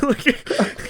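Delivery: normal talking voice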